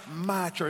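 "my" stretched and punched before "church"; stress on MY. Shown as a demonstration. Preacher shows congregation whose church it is, "my" heavily stressed. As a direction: lean hard on "my". Watch this now, singles out MY.

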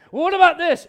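the devil pressing Jesus again; pushy devil. Retold or imitated. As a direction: jab back with a new trick. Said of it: "devil voiced in retold scene".

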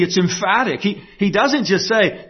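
normal talking voice

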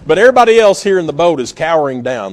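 normal talking voice

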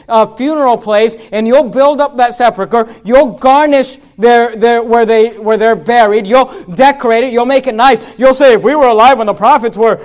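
full-force sermon delivery; loud preaching. Acted. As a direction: drive each line home from the pulpit. Preaching Jesus' rebuke.

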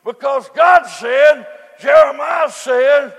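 loud, rhythmic preacher's chant; whooping sermon cadence, rising and falling; preaching at full force. Acted. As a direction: drive each phrase home like a revival preacher. Preaches on Jeremiah's tears.

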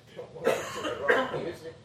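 laughing through the quote. Retold or imitated. Preacher repeating grandson's rock music line, laughing. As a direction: repeat it while laughing at how cute it was.

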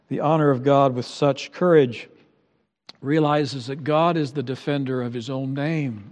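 usual speaking voice

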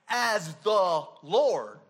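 slow, loud, stretched "as the Lord"; emphatic. Acted. Preacher to the congregation, slow loud emphatic Lord. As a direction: hammer "Lord" slowly and loudly.

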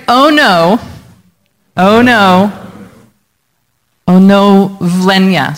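onovlenia split as "Oh no" then vlenia; mnemonic breakdown. Shown as a demonstration. Pastor breaking onovlenia into "Oh no, vlenia" for the church. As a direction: stretch "Oh no" apart from vlenia. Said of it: "So it's like".

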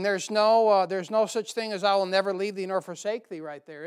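normal talking voice

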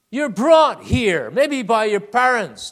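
slow loud proclamation. Real, not acted. Preacher proclaims slowly and loudly to congregation.

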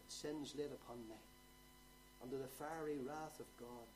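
usual speaking voice

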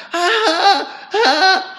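high mock cry of pain, laughing; pained yelp. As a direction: yelp like the father with his beard pulled. Padre gritando de dolor, bebé tira barba. (grito de dolor fingido, muy agudo)

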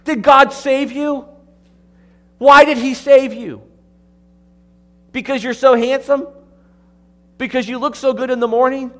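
loud, high, sarcastic questions from the pulpit; mocking preaching. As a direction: needle the congregation with mock reasons. Preacher mocks with rhetorical questions.

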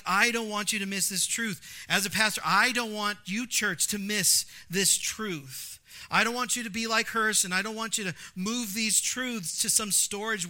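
loud, slowing pastoral plea; fervent preaching. Acted. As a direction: drive it home to the congregation.

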